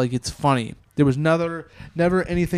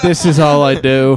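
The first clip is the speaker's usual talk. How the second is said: cracking up mid-line; laughing; 7 dB louder than usual. Real, not acted. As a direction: burst of laughter then words. Laughing at robot joke.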